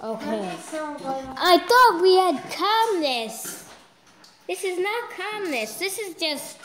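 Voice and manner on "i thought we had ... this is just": high-pitched exasperated whine; upset complaint. Real, not acted. Child complaining the calm is broken, exasperated whine. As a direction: protest in a high, let-down voice. Complaining about noise during recording.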